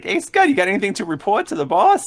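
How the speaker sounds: high-pitched character voice; Jimmy. Acted. Game master asks Scud for a report, high character voice. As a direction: pitch it high, rising into the question. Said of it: "and Jimmy's like".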